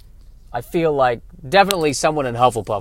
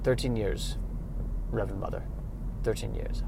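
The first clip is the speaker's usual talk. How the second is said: meek novice reply; 2 semitones lower, 10 dB quieter than usual. Acted. Meek novice answering Reverend Mother.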